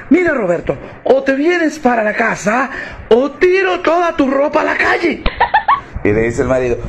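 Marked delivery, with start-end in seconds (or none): 0.1-5.2 wife threatening to throw out his clothes; angry wife. Acted. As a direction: bark the ultimatum.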